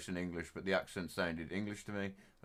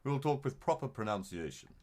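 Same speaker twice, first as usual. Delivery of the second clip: posh English; 4 semitones lower than usual. Retold or imitated. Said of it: "And then posh, you talk like this".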